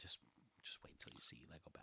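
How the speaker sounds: teasing whisper, fast. Real, not acted. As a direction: hush it like a secret tease. Teasing aside, hushed.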